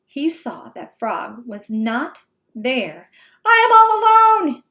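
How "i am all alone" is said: high, loud, fast panicked scream. Acted. Toad finding Frog gone on the speeding sled, screaming. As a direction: shriek as you realize you're alone. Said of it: "screamed Toad".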